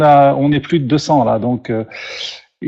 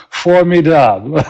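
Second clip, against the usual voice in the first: French word with a mock English accent. Shown as a demonstration. French host repeating his joke for guests, English accent. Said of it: "I say it in French with the English accent".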